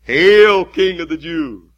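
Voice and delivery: bow and sneer the greeting; mock homage. Soldiers mocking the bound king, loud sneer. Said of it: "and do obeisance".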